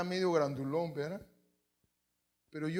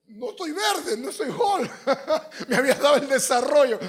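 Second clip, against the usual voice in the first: high, loud, through laughter; amused, high-pitched comic outburst; laughing protest; 8 semitones higher, 9 dB louder than usual. Real, not acted. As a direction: crack up while exclaiming it. Laughs at own Hulk growth story.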